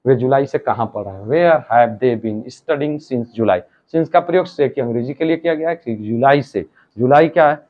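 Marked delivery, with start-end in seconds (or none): 1.3-3.6 very slow, each word spaced out; slow dictation. Shown as a demonstration. Teacher reads the translation for students to write, very slow.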